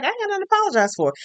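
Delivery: high, fast mock-indignant retort; indignant refusal. Lady Danbury refusing Brimsby's plea to apologize, imitated indignant refusal. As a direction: fire it back high and quick, imitating indignation.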